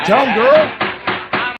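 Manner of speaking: slow, loud cheer of support. Real, not acted. Podcast host cheering on the villainess, loud and slow. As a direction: egg her on with a drawn-out shout.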